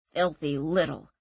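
low growled insult; growling. Acted. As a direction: growl it with contempt. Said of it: "growled Tomas".

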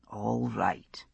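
barely voiced, quiet whisper. A narrator's reading. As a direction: whisper the first all right almost to nothing. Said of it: "he said".

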